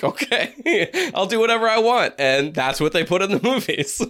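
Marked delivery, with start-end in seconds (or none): 0.0-2.1 high, laughing, devil-may-care voice; breezy actor, laughing through it; laughing actor impression. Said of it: "He's like".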